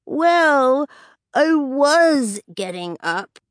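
slow, drawn-out retort. A narrator's reading. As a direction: stretch each word to provoke. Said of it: "he says slowly".

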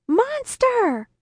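high-pitched cry of terror, drawn out; shouted alarm. A narrator's reading. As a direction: scream the word as a warning. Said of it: "I shouted".

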